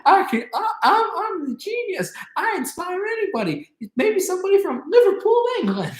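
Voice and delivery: very high, hammed-up bragging. Singer boasting of his genius in a sketch, high and hammed-up. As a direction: proclaim it in a squeaky-high comic voice. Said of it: "He goes, like".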